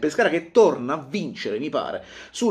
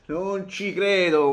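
disbelief; 6 semitones higher, much slower than usual. Real, not acted.

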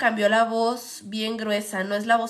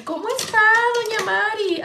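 higher, sing-song greeting of a market lady; nosy market lady; 10 semitones higher, 4 dB louder than usual. Retold or imitated. A lady in the market greeting grandma, higher sing-song. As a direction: greet grandma like a chatty señora. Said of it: "como esas señoras que".

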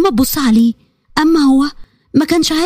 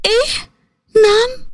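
much higher, near tears; shocked disbelief; 9 semitones higher, much slower than usual. A narrator's reading. Daughter hearing mother will be the cook, shocked. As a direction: gasp it out, stunned and hurt. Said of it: "هدى هنا اتصعقت باللي بيقوله".

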